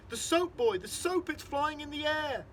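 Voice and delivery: high, loud amazement, stretching out at the end; agitated father, loud and high; squiffy with excitement. A narrator's reading. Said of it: "My daddy is squiffy with excitement".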